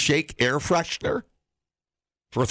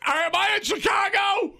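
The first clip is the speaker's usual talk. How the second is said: put-on drunk guy; slower than usual. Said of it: "I'm drunk".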